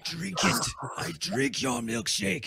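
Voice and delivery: slow, drawn-out movie villain line; quoted film villain. Acted. Host quoting a favourite movie villain, slow and theatrical. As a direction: deliver it like a hammy film villain.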